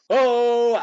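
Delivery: loud, high "Oh!"; catchphrase shout. Retold or imitated. Co-host mimics Duggan by the end of the match, loud catchphrase shout. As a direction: holler it like a wrestler working the crowd. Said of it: "he's going".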